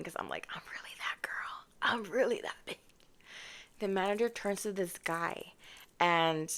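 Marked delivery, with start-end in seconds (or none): 0.0-2.8 whispering through laughter; hushed, amused aside; whispered giggling. Real, not acted. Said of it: "I'm laughing".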